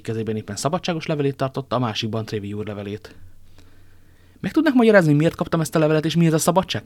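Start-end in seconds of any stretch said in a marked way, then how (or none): 4.4-6.8 high, loud bewildered demand; astonished questioning. A narrator's reading. Athos asks his friends about an unexpected letter, astonished. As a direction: demand an explanation in amazement. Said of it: "kérdezte csodálkozva".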